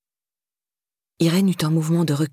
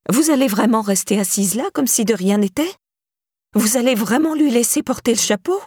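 raised-pitch incredulous questions; indignant challenge, climbing higher as it goes; 5 semitones higher than usual. A narrator's reading. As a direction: confront someone who will not react. Irène indignantly confronts silent Carla.

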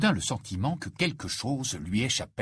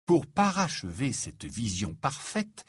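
usual speaking voice

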